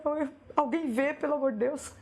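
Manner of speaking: high, pleading cry for someone to notice; pleading plea. Retold or imitated. Daughter pleading to be noticed, voice up high. Her own tearful plea, retold.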